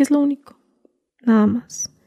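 hushed pleading whisper; whispered plea. Retold or imitated. Mother whispering her only request to the cartel boss. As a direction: beg softly under your breath.

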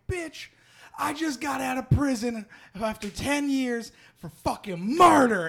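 high-pitched mock rage, building to a yell; retold shouting. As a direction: build to a huge blast on the last word. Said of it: "he's, like, shouting at her".

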